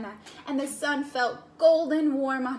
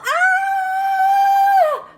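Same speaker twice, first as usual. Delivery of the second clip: scream; 14 dB louder than usual. Acted. Bart spinning on dandelion.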